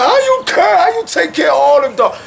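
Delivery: high shouted question from amazed people; mock amazed people. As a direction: squeal it like a stunned fan. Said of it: "You got my people like".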